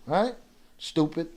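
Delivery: ordinary talk, nothing marked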